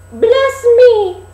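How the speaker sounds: put-on very high, loud, slow old peddler call; a disguised queen hawking like an old crone; peddler woman crying wares. Queen as old peddler calling at the dwarfs' door. Peddler fusses over laces.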